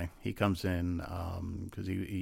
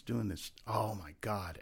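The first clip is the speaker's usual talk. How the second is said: usual speaking voice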